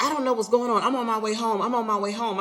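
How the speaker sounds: husband getting defensive. Retold or imitated. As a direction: play him flustered and defensive.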